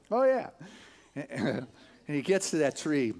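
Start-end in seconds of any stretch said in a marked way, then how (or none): none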